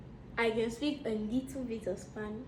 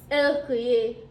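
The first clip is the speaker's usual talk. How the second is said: spoken out loud; 9 dB louder than usual. Real, not acted. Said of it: "Go slowly".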